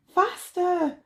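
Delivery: high, urgent plea; pleading. Acted. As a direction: urge her on desperately. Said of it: "Wendy pleaded".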